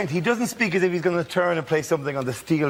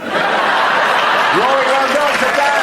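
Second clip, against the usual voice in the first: loud host dismissal; 10 semitones higher, 8 dB louder, much slower than usual. Real, not acted.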